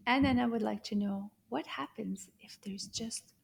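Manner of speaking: whispered explanation; soft voiceless telling. Shown as a demonstration. Teacher whispers Anana's question to the children.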